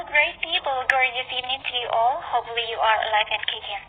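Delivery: ordinary delivery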